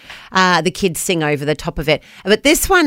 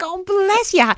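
higher, fond little bless you; fond mock sympathy; 12 semitones higher, slower than usual. Host recalling her fond reaction to the mum. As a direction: coo it like pitying the early mum. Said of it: "I was like".

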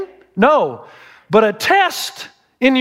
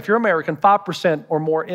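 ordinary talk

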